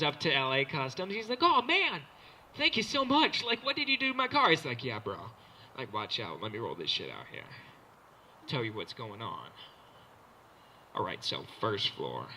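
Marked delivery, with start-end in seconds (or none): 1.4-4.5 high-pitched, gushing thanks; excited customer imitation; thrilled car owner. Grateful car owner, acted. 4.8-12.3 very low, softened cool-host voice; laid-back TV host pitched way down. Retold or imitated. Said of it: "like, Exhibit comes out".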